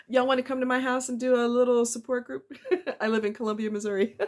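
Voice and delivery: amused, chuckling invitation; laughing. Real, not acted. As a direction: laugh off the emotion. Laughing off her sudden emotion.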